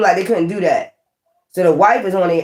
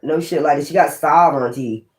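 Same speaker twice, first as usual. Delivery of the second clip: ordinary talk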